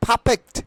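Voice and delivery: "perfect" said PHA-phekt, r dropped; breathy first p in "perfect", as pha-phekt; corrected pronunciation. Shown as a demonstration. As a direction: say "perfect" with puffed p and no r. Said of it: "it will be".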